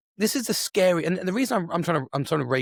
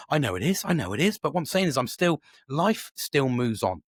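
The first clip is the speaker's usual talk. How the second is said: ordinary delivery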